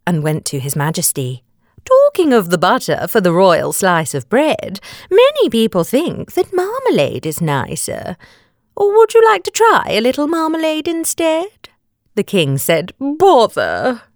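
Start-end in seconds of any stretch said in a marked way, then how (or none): 1.8-11.7 lilting queen, climbing higher toward the offer; high, polite royal suggestion; lightly voiced queen. A narrator's reading. Queen speaks to the king. 13.0-14.0 high, mock-petulant exclamation; pouting storybook king; put-out king. A narrator's reading. The king's disappointed reply.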